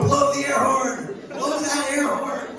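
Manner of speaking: high, drawn-out urging; mock urgent command. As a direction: egg him on, high and slow. Urging the man to honk.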